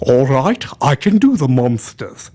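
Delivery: low, lisping monster-movie actor; Karloff. Acted. Explaining a movie voice, lisping horror-star delivery. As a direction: intone it like an old horror star. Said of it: "He did the Karloff voice".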